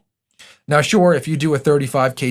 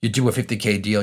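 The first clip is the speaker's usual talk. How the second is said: usual speaking voice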